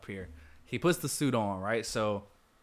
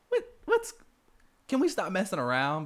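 exasperated Pepper; 9 semitones higher, 3 dB louder than usual. Retold or imitated. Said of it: "And then she's like".

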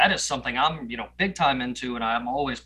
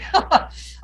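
embarrassed laugh; 6 dB louder than usual. Real, not acted. Embarrassed at name.

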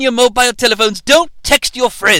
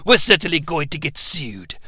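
usual speaking voice

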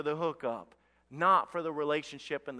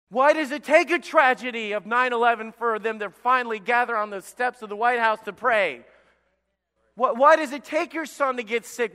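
loud, high, insistent challenge from the pulpit; impassioned preaching; 6 semitones higher, 9 dB louder than usual. Acted. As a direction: preach it as God demanding an answer, hammering each question. Preaches at full force, voicing God.

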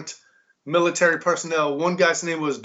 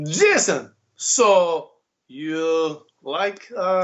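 Igor impression; much slower than usual. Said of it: "He goes".

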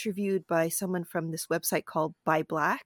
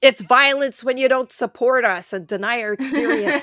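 louder, higher, driven home for everyone; emphatic repeat; 4 semitones higher, 7 dB louder than usual. Shown as a demonstration. Co-host asked to hear it one more time, loud and emphatic. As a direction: say it loud for the people out there. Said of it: "say that one more time for the people out there".

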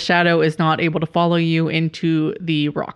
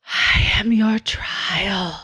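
slow, breathy whisper of a shadow beast; whispering wind voice; 3 semitones higher, much slower than usual. Acted. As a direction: whisper it like wind through trees. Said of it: "low voice that sounds like the wind whispering through the trees".

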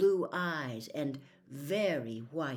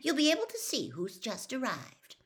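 brisk; 8 semitones higher than usual. Acted. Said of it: "said Hermione briskly".

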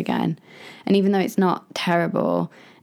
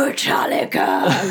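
mock rock shout; 5 semitones lower, 3 dB louder, slower than usual. To a four-year-old at snack time, mock rock cry. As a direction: throw out the band name like a headbanger, hushed. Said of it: "like that".

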